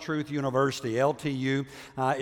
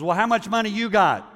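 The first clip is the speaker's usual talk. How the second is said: put-on little boy; 8 semitones higher, 6 dB louder than usual. Little boy asks brother.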